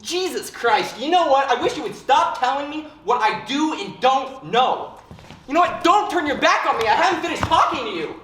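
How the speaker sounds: shouting, furious at being told; angry outburst. Acted. Harold explodes at Sam.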